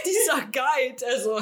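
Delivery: ordinary talk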